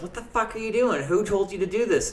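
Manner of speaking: high, exasperated mock chef yelling; angry sous chef. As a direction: imitate the sous chef's outraged disbelief. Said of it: "he looks at me and goes".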